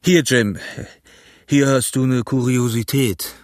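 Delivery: lightly voiced pirate leader; Silver, offhand. A narrator's reading. Silver gives Jim the paper, lightly in character. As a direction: hand it over with casual authority. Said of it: "sagte Silver".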